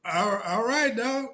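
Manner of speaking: high, baffled mock all right; stunned put-on voice; imitating his own confused reply; confused agreement. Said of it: "I'm like".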